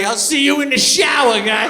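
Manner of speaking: loud mock tough-guy threat; tough dog. Dogs in the kennel threatening the poodle, loud mock tough guy. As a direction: growl a shower threat like a prison dog. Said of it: "the other dogs are like".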